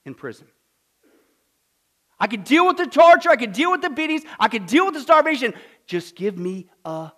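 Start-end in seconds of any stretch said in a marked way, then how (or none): 2.2-7.1 louder, higher, fierce resolve; defiant declaration. Acted. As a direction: proclaim it through the suffering. Prisoner quoted about his ordeal.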